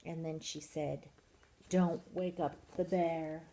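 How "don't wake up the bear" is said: ordinary delivery, nothing marked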